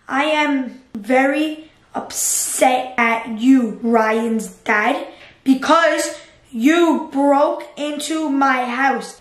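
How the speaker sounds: slow, word by word as he types; slow dictation. Real, not acted. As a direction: spell it out slowly while typing a message. Typing a text to Ryan's dad.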